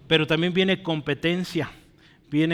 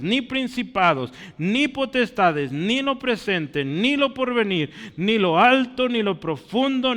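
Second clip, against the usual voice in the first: scripture reading; 3 semitones higher, 4 dB louder than usual. Acted. Preacher reading Romans to the congregation, proclaiming. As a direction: proclaim each clause of the verse.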